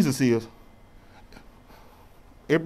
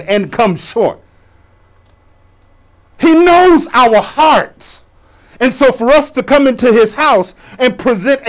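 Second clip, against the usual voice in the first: forceful, raised sermon delivery; preaching; 8 semitones higher, 7 dB louder than usual. Real, not acted. Preacher urging church to be real with God, preaching. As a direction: preach it hard to the congregation.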